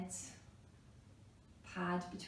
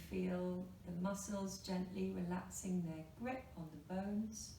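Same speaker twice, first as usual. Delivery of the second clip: normal talking voice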